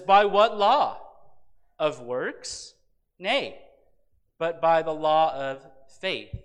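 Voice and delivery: emphatic question-and-answer reading, pausing between phrases; punchy, deliberate Bible reading; scripture reading. Acted. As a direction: declaim the verse in separate, forceful pieces. Reads scripture aloud.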